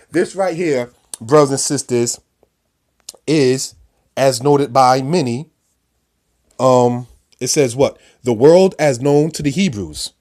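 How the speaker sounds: rising sermon delivery; preaching. Acted. Preacher raises voice over map.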